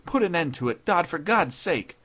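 high, loud, desperate begging. A narrator's reading. Judge imploring intruder to end it, loudly begging. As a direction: implore him with full urgency. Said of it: "he begged".

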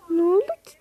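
ordinary talk

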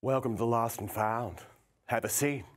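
dramatic bartender monologue, lingering on key words; theatrical bartender. Acted. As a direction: play a seductive barkeep working a customer. Performs one-man show bartender monologue.

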